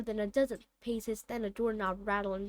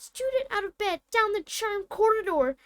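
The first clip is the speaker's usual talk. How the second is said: high, loud ghostly bellow; 9 semitones higher, 5 dB louder than usual. Acted. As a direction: raise the alarm, yelling the castle awake. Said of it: "Peev bellowed".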